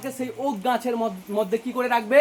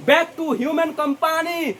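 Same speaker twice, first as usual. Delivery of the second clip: slow, drawn-out poem line; slow recitation; 6 semitones higher, much slower than usual. Shown as a demonstration. Teacher reading the poem line to students, slow recitation. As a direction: stretch the line out like verse. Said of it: "তখন বলছে".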